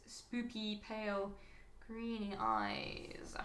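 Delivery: very slow, trailing into a whisper; playfully spooky. Pretend. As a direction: draw it out softly, hushed and eerie. Savouring the spooky eye description.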